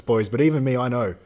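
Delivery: ordinary talk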